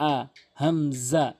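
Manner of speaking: হামজা said slowly with a clean glottal catch; hamza demo. Shown as a demonstration. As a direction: model হামজা carefully from the throat. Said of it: "এভাবে উচ্চারণ করবেন".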